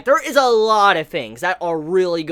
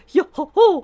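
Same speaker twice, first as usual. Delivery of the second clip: character's call; 9 semitones higher, 3 dB louder than usual. Retold or imitated. Quotes the character's jolly call.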